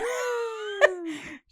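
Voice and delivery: long high-pitched laugh; high laughing fit; real laugh. Real, not acted. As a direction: crack up helplessly. Cracking up at street joke.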